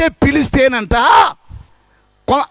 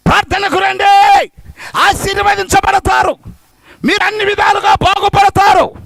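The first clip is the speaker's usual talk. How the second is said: fervent call to come; 8 semitones higher, 6 dB louder than usual. Retold or imitated. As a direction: call the crowd in with fervour. Voices preacher calling people.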